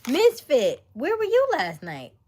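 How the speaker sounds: hollering a playful question; calling out. Real, not acted. Greeting Misfit in chat with a loud playful call.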